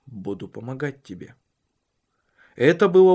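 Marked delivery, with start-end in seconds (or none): 0.1-1.4 much softer, gentle promise; soft and quiet. A narrator's reading. Husband gently offering help to wife, soft and quiet. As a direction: say it gently, almost under the breath. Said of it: "он мягко и тихо произнёс".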